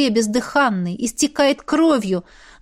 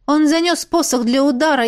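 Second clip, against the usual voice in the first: ordinary delivery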